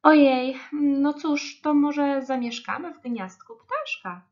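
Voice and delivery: lightly voiced rabbit mulling an idea; wondering rabbit. A narrator's reading. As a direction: start on a loud "oh dear", then wonder aloud. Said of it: "Zastanawiał się królik".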